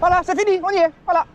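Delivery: excited. Real, not acted. Montée terminée, soulagement.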